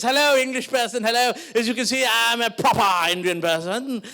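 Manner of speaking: put-on eager over-proper British-Indian accent; affected posh accent, stretching the key words. Posh friend greeting an Englishman, very posh accent. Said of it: "he's very posh".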